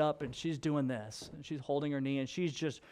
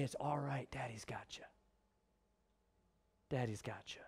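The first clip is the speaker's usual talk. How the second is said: whispered comfort, much softer; hushed father's whisper; tender whisper; 3 semitones lower, 10 dB quieter than usual. Pretend. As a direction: breathe it like God holding a child. God voiced comforting his child.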